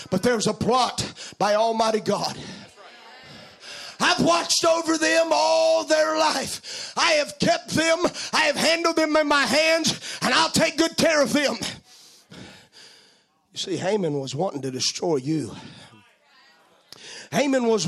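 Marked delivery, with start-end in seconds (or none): 0.1-11.6 fiery full-voiced preaching. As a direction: preach it out with fire.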